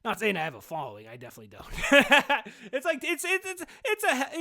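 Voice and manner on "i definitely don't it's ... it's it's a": laughing. Real, not acted. Laughing at own small following.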